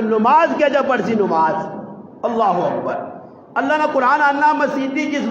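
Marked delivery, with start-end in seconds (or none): 2.3-3.1 much lower, quieter takbir; a low, subdued opening of prayer; prayer call imitated. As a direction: drop down low as if starting prayer. Acts out starting the prayer.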